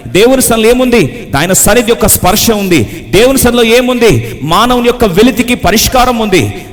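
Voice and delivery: high, loud rhetorical questions with ringing answers; full-force preaching. Acted. Preacher proclaiming what is in God's presence, full force. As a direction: fire out each question, then proclaim its answer.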